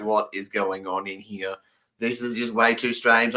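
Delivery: stunned disbelief. Retold or imitated. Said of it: "I'm like".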